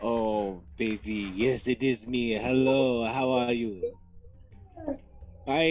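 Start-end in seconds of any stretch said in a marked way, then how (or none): none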